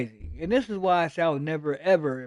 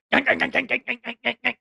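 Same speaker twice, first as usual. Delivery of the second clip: higher, rhythmic mock brake screech; screeching car brakes; 6 semitones higher, faster than usual. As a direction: chant the car grinding to a halt. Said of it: "that car just said".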